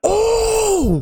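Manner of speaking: high startled yelp; shocked cry. Real, not acted. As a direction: jump at the sudden hit. Boss fight starts, shocked cry.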